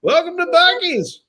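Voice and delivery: high, cheery greeter voice. Pretend. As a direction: greet customers in a sing-song high voice. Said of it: "interviewing people and being like".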